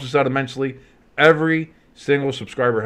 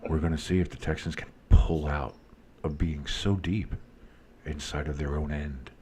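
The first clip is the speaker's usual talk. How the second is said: much lower, much softer, whispered play-by-play; football ASMR; 10 semitones lower, 11 dB quieter than usual. Shown as a demonstration. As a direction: whisper the game call like ASMR. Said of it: "football A S M R".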